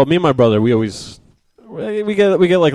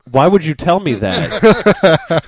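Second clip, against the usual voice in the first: mock slow exasperated; 6 semitones higher, 4 dB louder, much slower than usual. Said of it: "I'm like".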